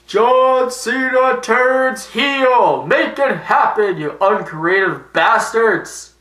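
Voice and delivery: high, loud, drawn-out yell; ranting shout. Real, not acted. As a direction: bellow it slowly like a demand to the bookers. Ranting plea to the bookers.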